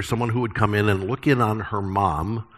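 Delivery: ordinary talk, nothing marked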